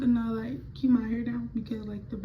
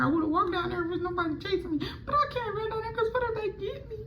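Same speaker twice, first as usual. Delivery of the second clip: much higher, near tears; panicky crying; 10 semitones higher than usual. Retold or imitated. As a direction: spiral up into panic. Panicked self quote, fear of chasers.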